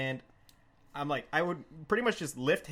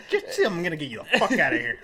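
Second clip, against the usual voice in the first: louder, hurried laughing command; urgent shout; 7 dB louder, faster than usual. Retold or imitated. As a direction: get him out now. Grabbing drunk friend.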